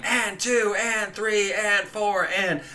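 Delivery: mock inner yell. Teacher imitating a yelling inner voice, mildly. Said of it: "your inner voice is just yelling".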